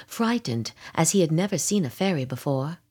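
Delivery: ordinary delivery, nothing marked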